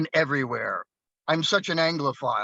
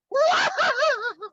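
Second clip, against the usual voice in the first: mock crying. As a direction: bawl in hammed-up lovers' tears. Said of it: "they were crying because they were".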